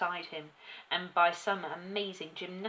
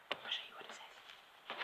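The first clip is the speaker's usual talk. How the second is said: very soft whisper; hushed, confidential real aside; 11 dB quieter than usual. Real, not acted. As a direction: whisper it to the listeners. Reader's hushed aside to listeners.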